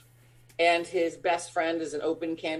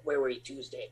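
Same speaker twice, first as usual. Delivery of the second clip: cop interrogation voice; 5 semitones lower, 8 dB quieter than usual. Retold or imitated.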